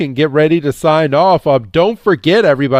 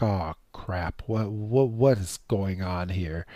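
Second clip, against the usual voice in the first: much softer, lower, muttered alarm; hushed worry; 6 semitones lower, 13 dB quieter than usual. Retold or imitated. Zur and Kanya panicking quietly as Amethyl walks off, hushed. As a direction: half-whisper it, like sneaking away. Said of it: "Zur and Kanya are like".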